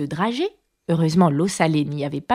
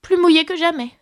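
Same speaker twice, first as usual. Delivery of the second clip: put-on higher, glum girlish voice; mildly downcast, pitched up; melancholy little girl; 9 semitones higher than usual. A narrator's reading. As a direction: answer mournfully as a small girl. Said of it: "répondit la fillette d'une voix mélancolique".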